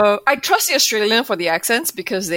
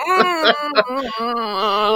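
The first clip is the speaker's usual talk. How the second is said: squirm wordlessly through the embarrassment; cringing groan; 3 semitones higher, much slower than usual. Retold or imitated. Podcaster reenacting involuntary cringe at accents, pained humming. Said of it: "cringe my heart out".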